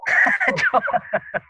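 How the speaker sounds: a sudden high-pitched laugh; high squealing laugh. Real, not acted. Laughs after teasing himself.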